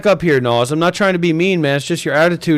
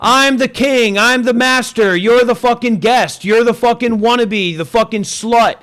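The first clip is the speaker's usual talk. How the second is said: higher-pitched, domineering rant; heated put-down; 4 semitones higher than usual. Real, not acted. Host cuts down a guest who argued back, heated and domineering. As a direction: lord it over the guest in a raised pitch.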